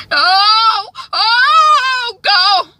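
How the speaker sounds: high, loud, long mock wail; imitated wailing. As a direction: cry out in acted grief. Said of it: "Candace continues crying".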